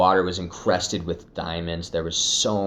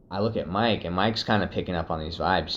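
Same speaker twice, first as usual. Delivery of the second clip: ordinary delivery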